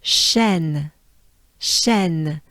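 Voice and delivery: "shen" with a soft, light sh and open eh; "shen" breathed gently on the sh. Shown as a demonstration. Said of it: "and a soft sh".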